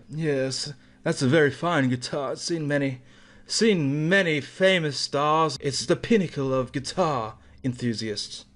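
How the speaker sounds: mock connoisseur, savouring the words; real connoisseur. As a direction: imitate a pretentious guitar expert with put-on refinement. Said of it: "talking like a real connoisseur".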